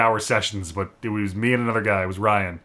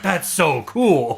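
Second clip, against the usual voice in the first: drawn-out, higher-pitched gushing; awed reaction; 5 semitones higher, much slower than usual. Retold or imitated. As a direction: stretch it out in mock awe. Quoted awe at title screen.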